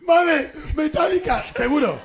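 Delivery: drawn-out sarcastic disbelief; sneering impression of a gullible cop; mock sarcasm. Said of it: "pero dijiste".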